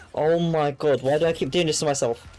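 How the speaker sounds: ordinary talk, nothing marked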